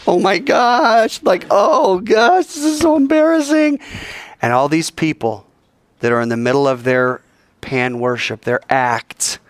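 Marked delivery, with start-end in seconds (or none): 0.1-3.8 high, slow, loud flustered gasping; hammed-up horrified onlooker; mock embarrassed shriek. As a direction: squeal it like a mortified bystander.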